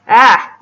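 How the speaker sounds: short, loud "ah" of alarm; a quick acted yelp; startled yelp. Startled by him coming close.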